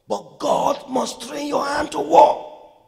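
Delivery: high, loud prophetic declaration; rousing pulpit shout; full-force preaching. Real, not acted. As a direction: build to a shouted promise.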